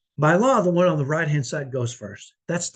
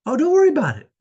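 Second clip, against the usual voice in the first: much higher and louder, breezy brush-off; sudden easygoing; 11 semitones higher, 7 dB louder, faster than usual. Retold or imitated. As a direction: toss it off brightly as if the rage never happened. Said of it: "he went from wanting to fight me to just".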